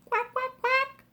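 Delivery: high, tiny quacks; squeaky baby duck quacks; ducklings quacking. Acted. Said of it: "says the ducklings".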